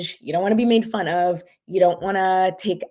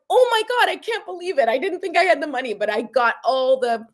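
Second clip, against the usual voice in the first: super excited; 3 semitones higher than usual. Pretend. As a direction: burst with joy, then calm down. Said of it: "super super excited".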